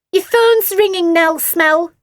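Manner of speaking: high, loud taunting squawk; bratty sister squawking a taunt. A narrator's reading. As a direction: squawk it at her big sister. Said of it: "she squawked".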